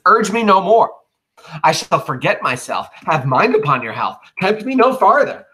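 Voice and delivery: high, loud threatening outburst; angry warning. Acted. Cassius warning Brutus to stop, rising angry threat. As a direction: warn him off, voice rising in anger.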